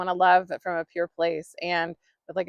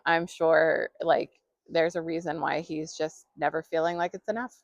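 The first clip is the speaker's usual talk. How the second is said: ordinary delivery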